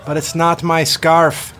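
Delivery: loud, high, slow called reply; calling down. Acted. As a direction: call it down, puzzled. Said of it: "she called down".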